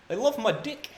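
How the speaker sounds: high, laughing Kiwi ad voice; New Zealand accent. Retold or imitated. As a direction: quote the ad in a Kiwi accent, cracking up. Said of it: "it was a New Zealand ad".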